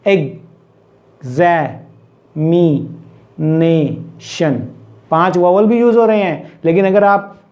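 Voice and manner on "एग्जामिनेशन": एग्जामिनेशन very slowly, a long pause after each syllable; एग्... ज़ा... मि... ने... शन, lower and isolated. Shown as a demonstration. Teacher inviting learners to say it, syllable by syllable. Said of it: "बोल के भी देख सकते हैं आप".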